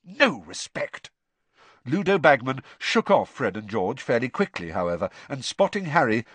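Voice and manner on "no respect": slow, higher-pitched indignation; prim, affronted; hissed disapproval. A narrator's reading. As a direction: draw it out disapprovingly. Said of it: "Percy hissed".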